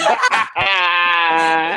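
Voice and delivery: loud, helpless burst of laughing; loud laughter. Real, not acted. Co-host cracks up at the rant, loud laughter. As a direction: crack up at full volume.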